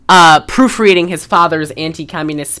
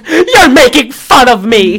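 near tears; 6 semitones higher, 6 dB louder than usual. Retold or imitated. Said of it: "And then she starts crying".

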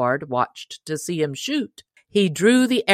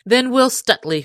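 ordinary delivery

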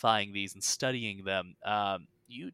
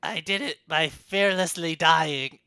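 hag voice; 3 semitones higher, 6 dB louder than usual. Acted. Hag voice continues.

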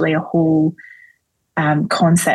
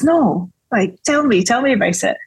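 lightly acted, higher-pitched coaxing; mild mock curiosity; playing innocent; 5 semitones higher than usual. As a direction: humour them, feigning ignorance. Jesus humouring them, acted.

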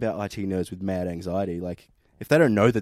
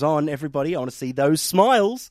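higher, louder upbeat boss voice; chirpy manager voice; 6 semitones higher, 5 dB louder than usual. Retold or imitated. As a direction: mimic a cheery team leader. Voicing cheery managers.

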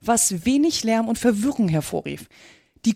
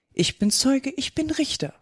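higher-pitched, crafty little boast; sly; 6 semitones higher than usual. A narrator's reading. Dog tells the mouse he runs the trial, slyly. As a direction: slyly claim both offices in verse. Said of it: "sprach er schlau".

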